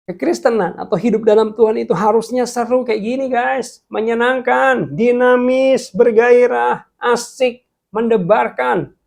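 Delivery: high, loud, fervent exhortation; rousing preaching. Real, not acted. Preacher urges viewers faith is exciting, loud fervent preaching. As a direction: fire up the congregation.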